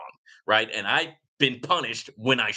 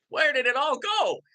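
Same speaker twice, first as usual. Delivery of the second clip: high, bewildered mock cry of someone who lost it all; a hammed-up gasp of loss, pitched way up; mock dismay; 12 semitones higher than usual. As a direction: play the stunned rich guy whose money vanished. Mock dismay of zeroed-out rich people.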